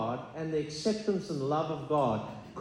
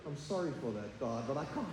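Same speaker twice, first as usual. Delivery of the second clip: meek apology; 4 semitones lower, 9 dB quieter than usual. Retold or imitated. Friend's meek reply to God.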